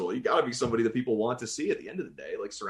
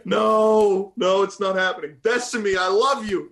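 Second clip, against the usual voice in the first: mock protest; 9 semitones higher, 7 dB louder, slower than usual. Playfully protesting the character idea.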